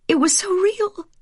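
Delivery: high lingering fear; still shaken. Acted. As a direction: still caught in the dream. Wife after nightmare.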